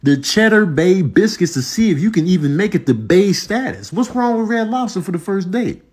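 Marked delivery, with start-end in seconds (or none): none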